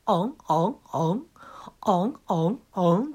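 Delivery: hammed-up high moans; mock moaning. Comedian recreating his onstage prank, mock porn moans. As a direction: moan like a cheap porn mag. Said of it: "僕の喘ぎ声がこだます".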